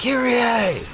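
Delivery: high mock yell of a name; Nero yelling. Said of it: "inexplicably yelling her name".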